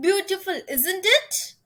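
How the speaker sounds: teacher demonstrating tag question intonation; rising tag. Shown as a demonstration. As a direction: lift the voice on isn't it. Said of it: "tag question".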